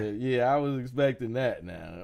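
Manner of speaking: ordinary talk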